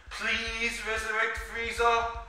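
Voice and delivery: high, drawn-out polite begging; acted meek plea. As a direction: plead slowly in a put-on high voice. Sorbet backs down and begs dragon.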